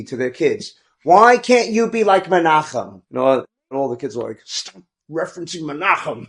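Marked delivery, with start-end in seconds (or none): 1.0-3.0 higher, drawn-out pleading complaint; nagging mother. Retold or imitated. Mother to her kids about the model boy, nagging. 4.4-6.3 higher, softer mock complaint; fed-up kid. Kids answering their mothers' comparisons, fed up.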